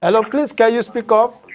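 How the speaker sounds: high, polite phone-line check; calling to caller. Real, not acted.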